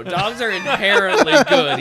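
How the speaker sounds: loud, high declaration through laughter; high-pitched, laughing outburst; laughing insistence. Real, not acted. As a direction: protest at full volume while cracking up. Laughing protest defending all dogs.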